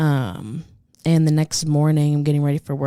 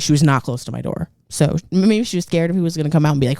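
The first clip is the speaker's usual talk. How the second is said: usual speaking voice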